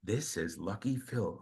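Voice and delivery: low-key, slow, soft introduction; imitating a bandmate. Host imitating bandmate introducing the man with the clipboard, hushed. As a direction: drop the voice and say it slowly, quietly.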